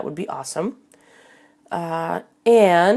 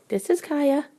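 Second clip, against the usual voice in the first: pet voice; 10 semitones higher than usual. Real, not acted. Host presenting her cat to viewers, high cooing.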